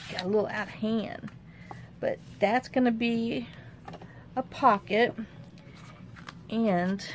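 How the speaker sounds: ordinary delivery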